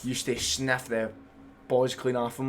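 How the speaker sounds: ordinary talk, nothing marked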